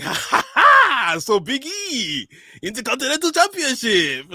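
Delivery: high, very slow hammed-up laugh drawn into the words; mock wrestler laugh. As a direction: imitate a cocky wrestler savouring the moment, laughing as he speaks. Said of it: "this laugh that he does".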